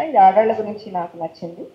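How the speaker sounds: ordinary talk, nothing marked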